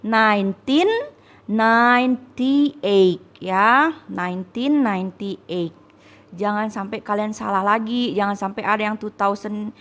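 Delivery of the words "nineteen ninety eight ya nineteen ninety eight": slow model reading. Shown as a demonstration. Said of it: "berarti nineteen".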